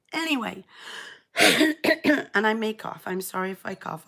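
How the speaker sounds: ordinary delivery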